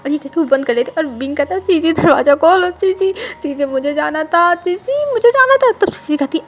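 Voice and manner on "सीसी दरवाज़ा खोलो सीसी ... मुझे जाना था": louder calls rising to a high yell; building shout, ending high and loud; shouting at the door. Acted. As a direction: bang on the door and call her out. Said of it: "क्योंकि wing चिल्ला रहा था".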